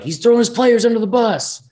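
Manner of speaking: high-pitched mocking complaint; mock outrage. Collective group taking it the wrong way, mock outrage.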